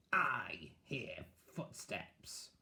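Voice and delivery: very slow, creaky witch voice. Acted. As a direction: draw it out like an old witch. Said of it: "said one of the witches".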